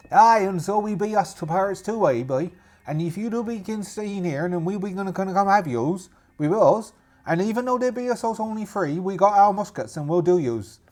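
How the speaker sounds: pirate voice, warning them off; threatening rival pirate. Acted. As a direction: threaten intruders in a put-on pirate accent.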